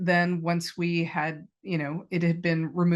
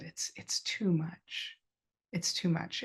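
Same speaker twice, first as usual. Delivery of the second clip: barely voiced, slow, broken whisper; 8 dB quieter, slower than usual. Husband whispers through welling tears, broken whisper. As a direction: whisper it, choked up, slowly. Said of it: "And he just said".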